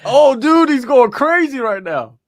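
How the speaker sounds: dude stretched high and loud; yelling with excitement as the fight goes wild; excited high shout. Real, not acted. Host watching the fight live, yelling excitedly high and loud.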